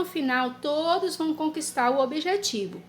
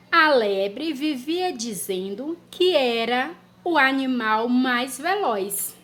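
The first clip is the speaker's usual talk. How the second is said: slow, word by word dictation; dictation pace; slower than usual. Shown as a demonstration. Teacher dictating a sentence to children. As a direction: dictate it slowly so children can write. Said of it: "Frase número um".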